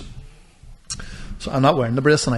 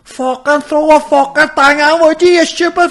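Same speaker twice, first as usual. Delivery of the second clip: high, drawn-out Northern Irish yell; Northern Irish shout; 13 semitones higher, 7 dB louder than usual. Acted. Bystanders yelling at a drowning man, exaggerated Northern Irish shout. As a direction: hammer up a loud, high Northern Irish rant. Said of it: "do you ever not like the Northern Ireland accent".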